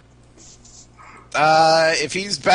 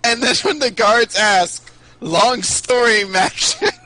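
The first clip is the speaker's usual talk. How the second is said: playful excuse; 8 semitones higher, 6 dB louder, slower than usual. Acted. Pitching the excuse to guards.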